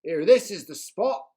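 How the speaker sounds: bossy pirate chief taking charge. A narrator's reading.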